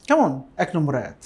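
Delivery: ordinary talk, nothing marked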